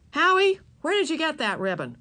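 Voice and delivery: lightly voiced mother questioning son. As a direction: demand an explanation.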